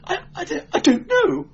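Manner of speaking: very high, stammering panic; shrill with nerves. A narrator's reading. Clerk denying knowledge to the inquisitor, shrill nerves. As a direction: squeak it out, stumbling on the first word. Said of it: "clerk's voice was shrill with taut nerves".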